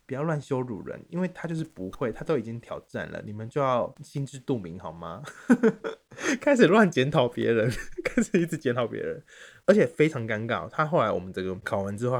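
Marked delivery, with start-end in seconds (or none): 5.3-6.3 high squealing laugh; high burst of laughter. Real, not acted. Laughing at own story. (高声爆笑)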